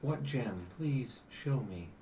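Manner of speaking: measured, grave and patient; celestial angel. Acted. GM voices the celestial.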